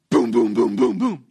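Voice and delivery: deep, loud, rhythmic booms; imitated door banging. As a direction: boom it low like pounding knocks. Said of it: "He bangs on the door".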